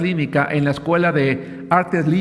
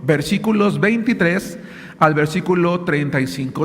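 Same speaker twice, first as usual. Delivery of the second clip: ordinary delivery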